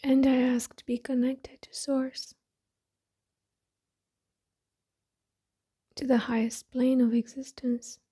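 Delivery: soft, breathy meditation voice with long pauses; hushed, unhurried affirmations spoken as if eyes closed; guided meditation. Acted. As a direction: settle inward, softly, phrase by phrase.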